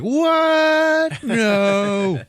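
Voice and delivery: very high, loud wail of disbelief; mock shocked outcry. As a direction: play a whole crowd gasping in horror. Crowd reacts in shock.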